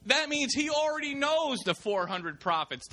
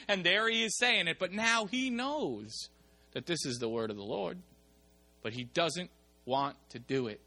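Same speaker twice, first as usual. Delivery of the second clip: ordinary talk, nothing marked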